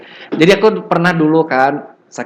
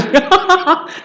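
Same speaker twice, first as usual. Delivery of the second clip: high burst of laughter. Real, not acted. Lecturer laughing at own story, high laugh.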